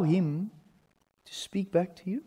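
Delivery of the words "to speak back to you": soft near-whisper question; hushed. Real, not acted. Preacher asks congregation about hearing God, hushed. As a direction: drop to a whisper, leaving room to listen. Quiet listening for God to speak.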